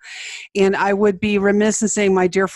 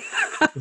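laughing; 6 dB quieter than usual. Real, not acted. Reacting to prairie dog impression.

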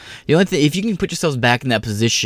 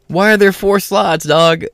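pointed challenge; 7 semitones higher, 6 dB louder, slower than usual. Retold or imitated. Pointing out to friends.

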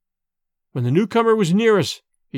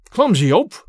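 put-on louder, irritated old man; rough rebuke; 3 semitones higher, 5 dB louder than usual. A narrator's reading. Wounded father to the son touching his side, rough and irritated. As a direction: push him off with the words. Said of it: "pushed Dorda away roughly".

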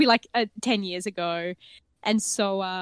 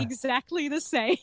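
put-on higher, deadpan German accent; German family accent; 4 semitones higher than usual. Game master defending the family voice, German accent. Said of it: "that is the Mueller family accent".

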